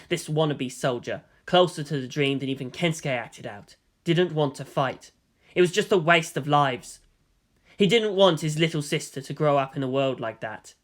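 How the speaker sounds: usual speaking voice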